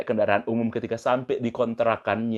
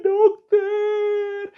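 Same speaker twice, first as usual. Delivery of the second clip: high-pitched wailing, imitating a weeping wife; mock crying; 4 dB louder than usual. Wife told she needs an operation, mock crying to the doctor. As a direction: wail the word out in exaggerated sobs. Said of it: "Istrinya katanya langsung menangis".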